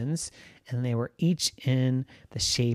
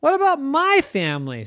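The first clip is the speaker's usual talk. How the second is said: Puppy's voice; 11 semitones higher, 3 dB louder than usual. Acted. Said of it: "said Puppy".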